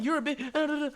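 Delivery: high mocking impression of the loudmouth. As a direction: parody his whining. Mocking the other guy's rant.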